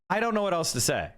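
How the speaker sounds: usual speaking voice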